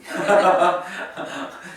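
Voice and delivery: a burst of real laughter at the answer; hearty amused laughter. Real, not acted. Student answers instantly.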